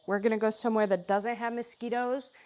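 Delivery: usual speaking voice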